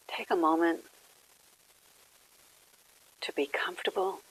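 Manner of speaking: gentle phrases spaced by long silences; guided meditation. Real, not acted. Leads a quiet meditation.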